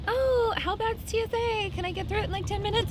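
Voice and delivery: high, airy, unhurried Carly voice; imitating a carefree friend asking for a favor; mock breezy friend. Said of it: "she's like".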